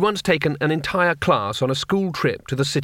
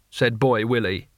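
usual speaking voice

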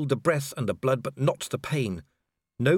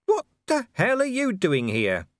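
exasperated demand; 9 semitones higher than usual. Acted. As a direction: demand an answer. Vimes demands explanation.

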